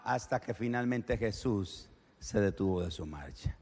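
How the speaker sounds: usual speaking voice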